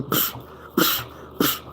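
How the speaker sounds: soft breathy psh like a flash going off; camera flash noise. Retold or imitated. Describing flashes with each hit, soft unvoiced psh. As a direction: puff out the flash sound under the breath. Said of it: "a camera flash".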